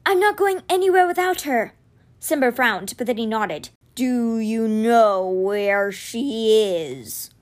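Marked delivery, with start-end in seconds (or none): 0.0-1.7 insistent. A narrator's reading. Insisting on rescuing his friend. 3.9-7.3 very slow, drawn-out big-cat voice; giant cat. A narrator's reading. Giant cat's dialogue.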